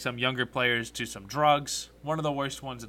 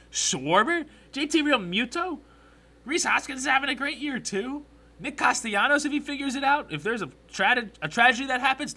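excited; 7 semitones higher, 4 dB louder than usual. Real, not acted. Hyped list of Phillies hitters.

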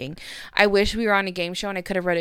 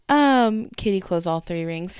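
ordinary talk

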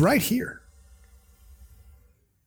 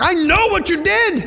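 stern accusing God; 13 semitones higher than usual. Retold or imitated. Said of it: "come down with the hammer like".

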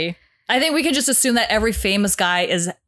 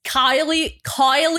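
Aussie accent; 7 semitones higher, much slower than usual. Shown as a demonstration. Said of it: "can you do it in both?".

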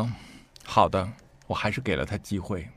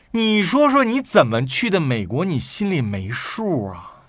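furious outburst; 8 semitones higher, 5 dB louder, slower than usual. Retold or imitated. Brother scolding his truant sister, furious. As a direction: voice jumps high and loud in anger. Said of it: "就火冒三丈".

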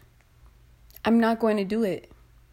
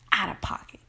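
voiceless aside; whisper; 3 semitones lower than usual. Real, not acted. Judging the guy's message in a whisper. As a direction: breathe the verdict out.

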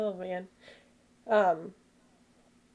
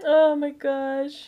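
laugh-crying; 5 semitones higher, 4 dB louder, slower than usual. Real, not acted. Laughing to tears.

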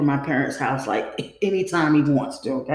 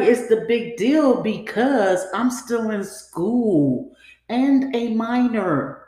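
each word dragged out slowly to make the point; slow insistence; 2 semitones higher, much slower than usual. Retold or imitated. Teen girl insisting to boyfriend she's a minor, slow and firm. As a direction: spell it out, slow and deliberate.

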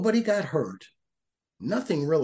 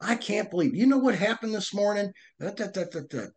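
telling spouse; 9 semitones higher, faster than usual. Retold or imitated. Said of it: "talking to your wife".

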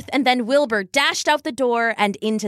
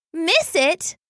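sniffling; 7 semitones higher than usual. A narrator's reading.

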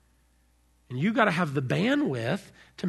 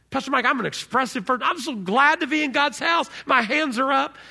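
higher, louder, eager worshipper voice; excited congregant; 6 semitones higher, 4 dB louder than usual. Retold or imitated. Pastor voicing an expressive congregant, eager and excited. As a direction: hands up, gushing with joy. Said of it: "Some of you say".

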